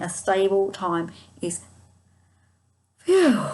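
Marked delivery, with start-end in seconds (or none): 3.0-3.5 relieved phew. Retold or imitated. Angels relieved, sigh of relief.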